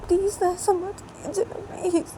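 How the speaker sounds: high, tearful begging; sobbing plea please; pleading tearfully. Acted. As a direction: beg through tears. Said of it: "PU को बोलती है".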